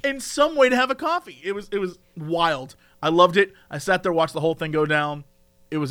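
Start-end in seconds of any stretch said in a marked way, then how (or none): 0.0-1.4 higher, insistent baffled questions; incredulous rant. Real, not acted. Storyteller baffled by a man new to coffee, incredulous rant. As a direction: fire off questions in comic disbelief. Ranting in disbelief about customer.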